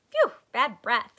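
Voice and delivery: wrinkle your nose and blurt it; disgusted phew. Acted. Stink reacting to a bottle, loud and disgusted.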